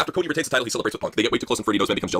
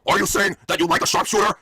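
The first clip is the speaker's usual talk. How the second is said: higher, threatening principal; Bret Hart; 10 semitones higher, 3 dB louder than usual. Acted. Principal Bret threatening an administrator, raised stern voice. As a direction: challenge the doubter indignantly. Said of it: "I'll be the, the principal".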